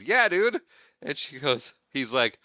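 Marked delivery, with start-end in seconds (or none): 0.0-0.6 casual quip. Retold or imitated.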